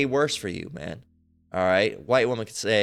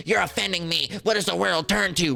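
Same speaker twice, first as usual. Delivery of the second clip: higher, mock-offended protest; mock outraged woman; 6 semitones higher than usual. Accuser protesting to police, mock outrage.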